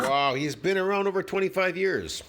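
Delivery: high, sarcastic mock awe. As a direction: pretend to be impressed. Mocking the clip's boast.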